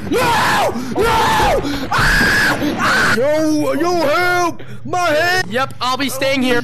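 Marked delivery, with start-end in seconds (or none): 0.1-5.4 high frightened yells; panicked shouting. Real, not acted. Cornered by monster, screaming.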